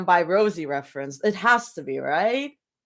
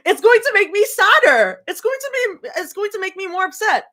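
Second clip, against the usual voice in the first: high-pitched whine; 11 semitones higher, 6 dB louder than usual. Real, not acted. Dreading sad flashback chapter.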